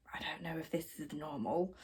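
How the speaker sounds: very soft, whispered aside; imitated whisper. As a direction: whisper it so the client can't hear. Megan's aside about the bride.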